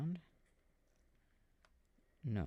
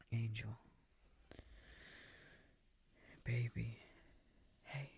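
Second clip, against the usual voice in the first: tender whisper. Real, not acted. Whispering to the baby.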